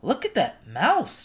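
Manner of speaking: high, loud, slow monkey call; a monkey chattering in surprise. A narrator's reading. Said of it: "chattered a monkey".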